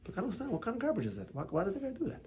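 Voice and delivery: quiet, lightly acted disbelief; hushed imitation of a puzzled judge of others; mock bafflement. Onlooker judging someone who sins, softly mock-baffled.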